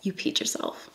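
breathy near-whisper, imagined paramedic scoffing; mocking paramedics. Woman on the floor imagining paramedics mocking her, hushed scoff. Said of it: "they're like".

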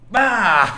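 1 grido da bullo. Acted. Bullo lancia la palla.

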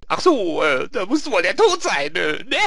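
high, loud, dawning realisation; hesitant character catching on; Lee. Acted. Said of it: "Und der Lee".

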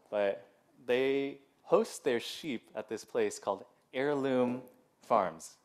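usual speaking voice

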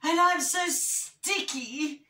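high, slow whinge; hammed-up moany bear; moaning. A narrator's reading. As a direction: drag out the complaint up high. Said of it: "moaned Medium Bear".

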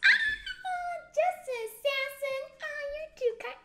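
very high, gushing delight; high-pitched flattered thanks; flattered squeal. Real, not acted. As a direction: gush it high, bursting in loud.

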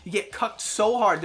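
normal talking voice

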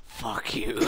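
breathless laughing whisper. Real, not acted. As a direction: squeeze it out through giggles. Laughing too hard to speak.

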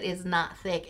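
usual speaking voice

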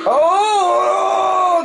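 high yelp of surprise; sudden high squeal; surprised shout. Real, not acted. Winning the last race.

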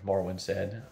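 ordinary delivery

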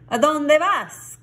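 loud, high-pitched call. A narrator's reading. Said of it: "called out Scapito".

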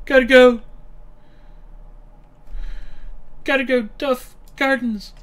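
frantic. Real, not acted. Rushing units, frantic calling.

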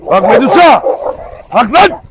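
panicked yelling at dogs; alarmed shouting. Real, not acted. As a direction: bark commands to stop the fight. Dogs fighting, owner yells.

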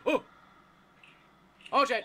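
sudden startled yelp; loud scared outburst; panicking gamer. Real, not acted. Chased by monster in game.

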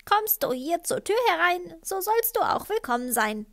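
high, loud parrot screech; squawking parrot. A narrator's reading. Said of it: "denn er krächzte". (krächzender Papagei, hoch und laut)

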